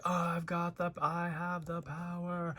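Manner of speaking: slow heroic proclamation, hammed-up but hushed; He-Man yelling. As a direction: pretend He-Man declaring his power. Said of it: "And he's yelling".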